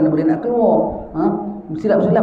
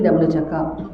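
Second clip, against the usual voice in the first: ordinary delivery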